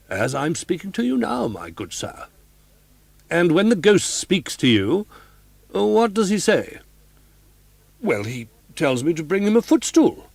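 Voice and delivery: put-on a homely old boxkeeper, a bit indignant; old boxkeeper woman. A narrator's reading.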